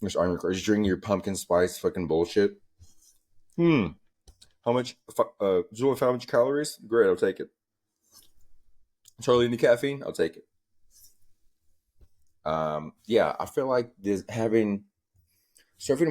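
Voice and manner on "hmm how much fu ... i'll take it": mock shopper voice. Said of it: "you're like".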